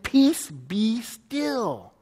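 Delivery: very slow, drawn-out command; lightly voiced Jesus calming the sea; divine command. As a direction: stretch Peace out, calm and commanding. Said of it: "commands the wind".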